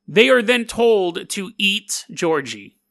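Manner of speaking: ordinary talk, nothing marked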